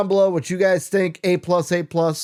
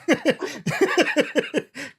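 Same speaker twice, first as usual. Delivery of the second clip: high laugh; 12 semitones higher than usual. Real, not acted. Laughing at the story.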